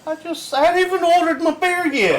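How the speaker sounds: very high, squeaky put-on voice; mock innocent protest. Patron threatened before ordering his beer, mock high-pitched protest. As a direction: plead innocence way up high, hammed-up.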